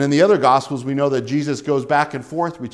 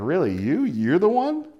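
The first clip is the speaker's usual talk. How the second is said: doubtful, with a high rise at the end; can't believe this is the man; incredulous questioning; 3 semitones higher than usual. Acted. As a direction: size him up in disbelief. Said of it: "He's not coming in in a kingly way".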